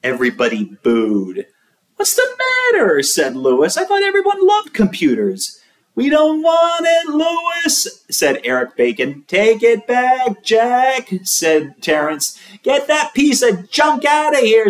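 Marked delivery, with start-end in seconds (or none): 1.9-3.0 very high voice. A narrator's reading. Lewis asks class. 3.8-5.6 very high voice. A narrator's reading. 5.9-8.0 put-on high kid voice. A narrator's reading. Kids booing. 9.3-11.1 put-on high kid voice. A narrator's reading. Kid complains. 12.6-14.7 put-on high loud kid. A narrator's reading. Kid rejects computer.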